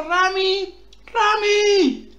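high, rising invocation of a name; calling out. Real, not acted. Streamer calls on new follower Rami for luck, calling out.

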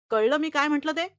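loud, high demand. Acted. Teacher turns to the class, demanding loudly. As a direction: hammer home the warning. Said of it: "ते उद्गारले".

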